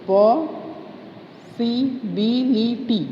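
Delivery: "Possibility" very slow, पॉ, सि, बि, लि, टी; long break after "पॉ", then each beat of "possibility" apart; syllable by syllable. Shown as a demonstration. Teacher restarting possibility, slowly syllable by syllable. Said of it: "four syllables".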